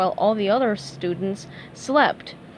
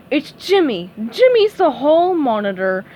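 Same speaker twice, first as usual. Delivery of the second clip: loud, higher hopeful exclamation; exclaiming; 4 semitones higher, 5 dB louder than usual. A narrator's reading. Teacher exclaims a name to avoid SpongeBob, loudly. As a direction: blurt it out to dodge the dread. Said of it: "Missus Puff exclaimed".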